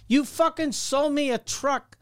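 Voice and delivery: high-pitched indignant rant, not shouted; retold angry complaint. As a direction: re-enact chewing out the salesman. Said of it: "I was so angry".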